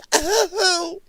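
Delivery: high-pitched put-on whimper; mock distressed wail. Vlogger mocking a woman upset at a glance, high fake wail.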